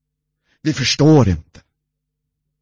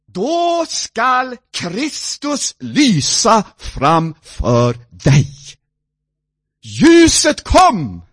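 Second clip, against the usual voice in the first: higher, louder, slow proclamation; preaching; 8 semitones higher, 5 dB louder than usual. Acted. Preacher proclaiming that the light came. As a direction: announce the coming light with awe.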